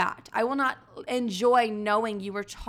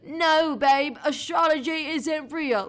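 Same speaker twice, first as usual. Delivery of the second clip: put-on higher, louder slow condescending boyfriend; louder mock of a boyfriend brushing off astrology; mocking skeptic boyfriend; 6 semitones higher, 4 dB louder, slower than usual. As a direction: parody a dismissive boyfriend. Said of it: "I don't want him to be like".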